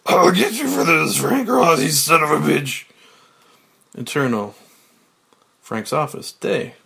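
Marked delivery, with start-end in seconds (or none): 0.1-2.8 furious shouted threat at the building; screaming foiled villain. Acted. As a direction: yell it up at the skyscraper, fist raised. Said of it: "Elliot, screaming".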